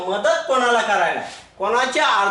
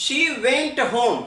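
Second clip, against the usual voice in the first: very slow, word by word; dictating an English example sentence to a class; slow dictation; 2 semitones higher, much slower than usual. Shown as a demonstration. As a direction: read it out slowly for students to copy.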